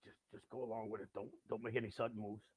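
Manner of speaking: low, soft, careful undertone; wary aside. Retold or imitated. Storyteller to himself beside the bodyguard, hushed caution. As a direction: keep it down and tread carefully.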